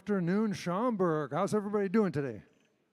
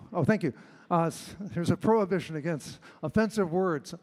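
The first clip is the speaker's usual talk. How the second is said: normal talking voice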